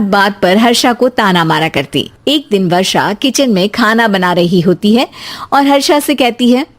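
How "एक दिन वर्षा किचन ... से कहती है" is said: ordinary delivery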